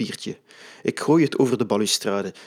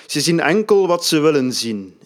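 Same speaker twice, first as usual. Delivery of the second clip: rising, louder and higher; growing intensity; 4 semitones higher, 5 dB louder than usual. A narrator's reading. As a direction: build to a fervent pitch. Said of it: "Zijn stem wint aan intensiteit".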